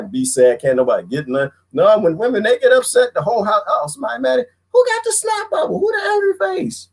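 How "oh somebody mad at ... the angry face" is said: very high, teasing whisper-like voice; hammed-up high nervous voice; mock fearful family member. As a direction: tiptoe around the angry wife. Mocking household tiptoeing around wife.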